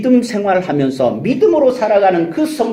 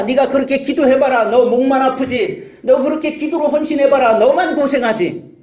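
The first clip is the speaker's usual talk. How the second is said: higher, sneering devil taunt; preacher voicing the devil who mocks prayer, higher and sneering; mocking devil; 7 semitones higher than usual. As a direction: taunt the praying believer. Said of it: "기도해도 소용없다고 우리를 비웃습니다".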